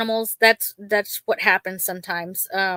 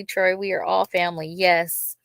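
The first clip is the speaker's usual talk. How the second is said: usual speaking voice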